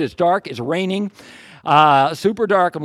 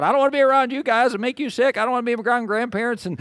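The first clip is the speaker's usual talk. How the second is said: high-pitched retold fretting; mock worried protest, pitched up; mildly freaking out; 7 semitones higher, faster than usual. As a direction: imitate a fretting daughter in a raised pitch. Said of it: "she's kinda freaking out".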